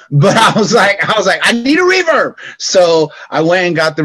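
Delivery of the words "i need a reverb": put-on pitched way up, begging like a kid; high insistent kid. Telling dad he needs a reverb, high eager plea.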